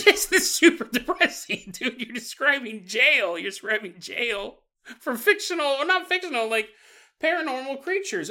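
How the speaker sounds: high, loud incredulous rant; mock outrage. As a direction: act scandalized at the friend. Friend mocking a sad zoo idea.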